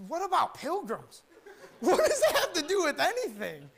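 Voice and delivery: high, laughing disbelief at the hymn; incredulous laugh. Retold or imitated. First-timer at church mocking a hymn, laughing. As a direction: laugh off the odd lyric as nonsense. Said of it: "I said".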